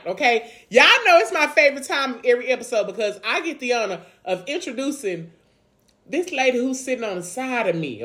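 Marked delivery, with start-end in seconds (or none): none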